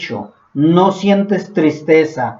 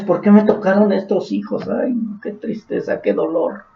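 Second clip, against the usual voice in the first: sighing weary parent; 4 dB quieter than usual. Acted. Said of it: "O los padres".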